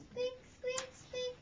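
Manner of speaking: high, loud squeaky mouse noises; mouse squeaks. Acted. Mouse squeaks before note.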